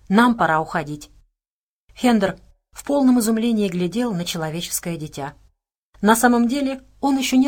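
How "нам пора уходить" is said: plain reading voice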